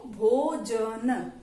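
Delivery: भोजन stretched very slowly, syllable by syllable; teacher sounding out भोजन slowly. Shown as a demonstration. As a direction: draw out भोजन slowly for a child to copy. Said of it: "एक साथ बोल के देखो".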